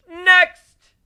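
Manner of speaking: loud, high shout of next; calling out loud. Acted. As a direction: call the next one in.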